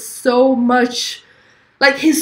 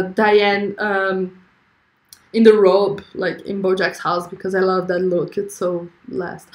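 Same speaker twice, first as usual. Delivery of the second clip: ordinary talk, nothing marked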